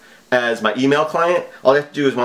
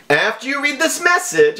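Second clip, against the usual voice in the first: high, theatrical villain read of the email; mock scammer voice; 10 semitones higher than usual. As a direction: read the scam letter like a hammy hacker. Reading scam email in mock voice.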